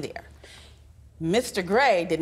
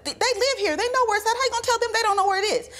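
much higher and fast, indignant pushback; exasperated retort; 14 semitones higher, faster than usual. Retold or imitated. Wife replaying her retort to husband in the car, high and fast. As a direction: fire back fast in disbelief.

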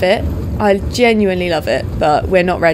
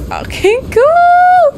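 high and slow, drawn-out awe; mock-grand high gush; put-on awed voice; 6 dB louder, much slower than usual. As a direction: stretch it out in a high silly voice. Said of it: "I said it in that voice".

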